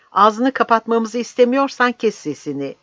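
heated captain's ultimatum; angry order. Acted. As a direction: warn him sharply to shut up. Captain orders him silent.

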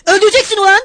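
high, loud cry of protest; alarmed shout. A narrator's reading. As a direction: yell a warning at the brute. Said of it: "diye bağırdı".

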